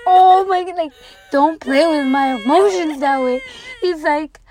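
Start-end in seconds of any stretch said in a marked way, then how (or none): 0.1-3.4 mock-indignant outburst. Runner to the man who was just kidding, mock-indignant. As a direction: act out exasperated relief. Retold protest after the prank reveal.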